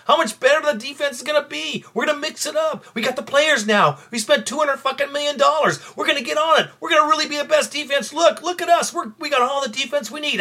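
high, fast mocking of training camp hype. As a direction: parrot the preseason boasts, sneering. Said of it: "this is bullshit we heard during training camp".

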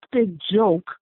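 ordinary delivery

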